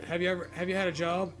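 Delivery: ordinary talk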